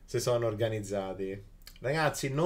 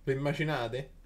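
usual speaking voice

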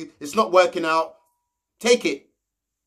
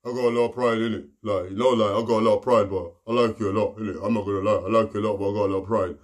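much lower, low and soft put-on voice; deep voiced Ovi; 11 semitones lower, 3 dB quieter, faster than usual. Retelling Ovi's talk to Anna, low put-on voice. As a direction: drop the voice low to play the proud guy. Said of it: "he was saying".

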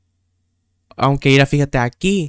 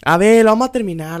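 quick, high, loud rallying; rally yourself to get it finished; loud resolve; 4 semitones higher, 7 dB louder, faster than usual. Real, not acted. (resolución en voz alta y rápida)

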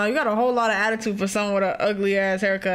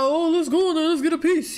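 imitating the character; 5 semitones higher than usual. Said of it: "Remember you was talking about".

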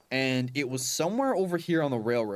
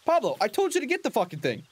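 higher, half-joking exasperated scolding; play-scold the dog that won't fetch; mock exasperated scolding; 7 semitones higher than usual. Real, not acted.

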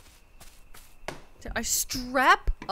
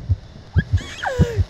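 sharp high gasp; possessed gasp; 11 semitones higher, 7 dB louder than usual. Acted. Possessed scare gag.

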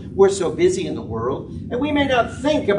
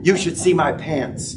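lower, smooth apologetic pilot voice; lightly voiced pilot, calm and apologetic; 6 semitones lower than usual. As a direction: play the sheepish captain over the intercom. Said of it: "the pilot comes out".

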